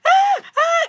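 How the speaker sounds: two high, loud mock shrieks; acted screaming. Acting out panic in a dark room, screaming. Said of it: "you don't start screaming".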